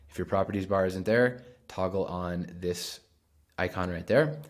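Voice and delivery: ordinary talk, nothing marked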